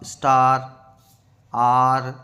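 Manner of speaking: "स्टार", "आर" loud, with a long "aa"; stretched AA sound. Shown as a demonstration. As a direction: hold the vowel in "स्टार" and "आर". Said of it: "इसमें भी आ की है".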